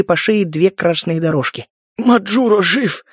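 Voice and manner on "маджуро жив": slow hoarse wheeze; raspy whisper. Acted. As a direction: rasp it out, barely breathing. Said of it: "просипел он".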